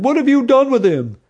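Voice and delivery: high, loud angry demand. A narrator's reading. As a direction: hurl the question at him. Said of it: "one demanded".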